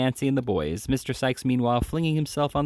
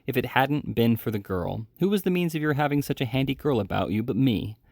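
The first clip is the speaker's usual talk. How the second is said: plain reading voice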